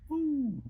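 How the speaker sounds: a sudden very high woo; a quick high-pitched woo of excitement; excited whoop. Real, not acted. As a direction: whoop with delight. Excited about the reading.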